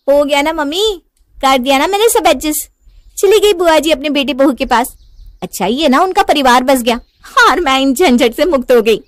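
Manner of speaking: high, loud triumphant chatter; gleeful. Acted. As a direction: crow to your mother that you won. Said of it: "खुशी खुशी रहने लगती है". (खुशी से चहकते हुए)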